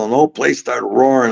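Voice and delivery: ordinary talk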